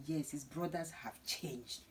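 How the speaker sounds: ordinary talk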